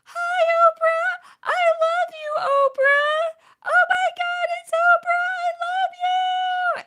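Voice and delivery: high, loud, drawn-out fan scream; full-voiced, high-pitched screams stretched long; starstruck screaming. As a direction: shriek it out like an uncontrollable fan. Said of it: "I'm screaming and shouting".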